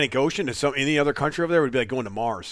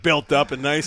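ordinary delivery

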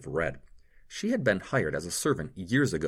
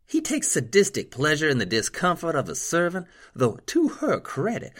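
a hint of a higher nurse voice; lightly voiced nurse; 9 semitones higher, 7 dB louder than usual. A narrator's reading. Marie urging Cameron to heal the nobleman, lightly voiced. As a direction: urge him to hurry, lightly voiced.

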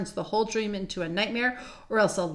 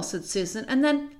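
ordinary delivery

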